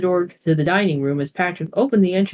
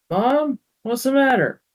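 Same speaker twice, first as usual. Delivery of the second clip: grumbling; 7 semitones higher, slower than usual. Acted. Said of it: "grumbled Boris".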